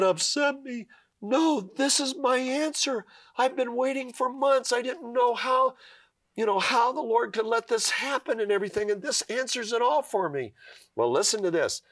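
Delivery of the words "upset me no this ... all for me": much higher, emotional voice, voicing the crying friend; overwhelmed, tearful joy; tearful relief. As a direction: play her through tears of relief, pitched way up. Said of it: "and then she starts sobbing".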